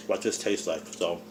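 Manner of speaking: talking through a mouthful; words round a bite of food; mouth full. Real, not acted. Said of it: "I am talking with my mouth full".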